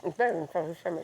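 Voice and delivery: mumbled through a mouthful of food; mouth full. Real, not acted. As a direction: talk around a bite. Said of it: "talking with my mouth full".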